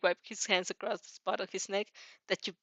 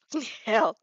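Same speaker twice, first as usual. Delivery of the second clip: furious curse, louder; angry cry; 5 dB louder than usual. A narrator's reading. Bully storming home, cursing angrily. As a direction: bark it out. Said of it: "he cried out".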